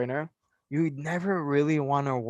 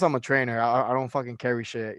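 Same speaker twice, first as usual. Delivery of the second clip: ordinary delivery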